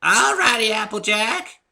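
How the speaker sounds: high, loud, put-on old granny; old woman's creaky voice. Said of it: "came an old woman's creaky voice".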